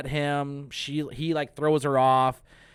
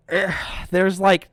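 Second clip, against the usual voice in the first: ordinary talk, nothing marked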